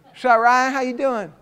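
high, loud country twang; hammed-up country accent. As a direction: imitate a new-made cowboy's country greeting. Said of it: "he's talking like this now".

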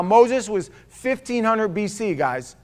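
ordinary talk

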